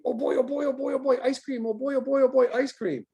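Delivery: high, eager doggy chatter; giddy pup babbling about ice cream; excited dog inner voice. Acted. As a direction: voice a dog that can't contain itself. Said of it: "those dogs are thinking".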